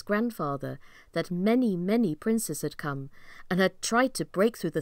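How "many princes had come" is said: plain reading voice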